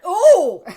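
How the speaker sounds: sudden loud, high "oh!"; imitated shocked cry. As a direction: blurt out a mock-horrified yelp. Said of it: "Like that".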